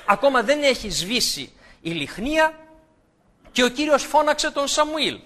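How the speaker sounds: ordinary delivery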